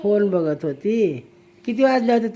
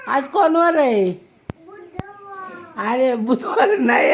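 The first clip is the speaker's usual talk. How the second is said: ordinary talk, nothing marked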